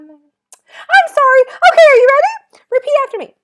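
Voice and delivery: loud, silly, squeaky voice way up high; super high-pitched voice. Shown as a demonstration. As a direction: squeak it out at the very top of your range. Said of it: "with a super high-pitched voice".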